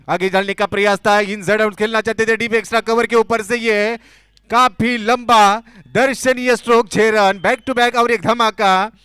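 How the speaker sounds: loud, high, stretched six call; excited cricket commentary. Real, not acted. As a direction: roar the back-to-back sixes. Back to back sixes called.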